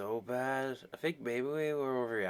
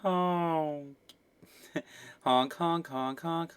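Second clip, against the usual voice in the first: laughing clown honks; 6 semitones higher, much slower than usual. Acted.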